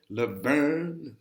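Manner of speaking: plain reading voice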